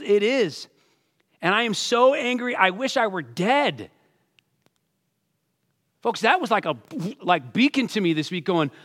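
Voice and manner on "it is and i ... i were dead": hammed-up sulky complaint, a bit louder; mock whining anger. Preacher voicing Jonah whining over the vine, mock angry. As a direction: whine like a sulking prophet. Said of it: "He says".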